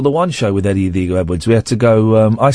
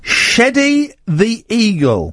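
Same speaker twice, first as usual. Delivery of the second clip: slow, stretched, higher headline voice; headline read; 7 semitones higher, much slower than usual. Acted. Host reading a tabloid headline, slow and high. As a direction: announce the pun headline grandly.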